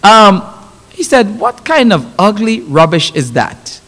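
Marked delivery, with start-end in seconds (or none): 1.4-3.8 lower, restrained gruff dismissal; lightly voiced old Caribbean man; a hint of a stern elder, pitched down; old hard Caribbean man. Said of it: "this is an old, hard Caribbean man".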